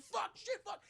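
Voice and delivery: high-pitched mock panic; panicked cursing. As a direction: squeal curses while under attack. Retold panic while being stabbed.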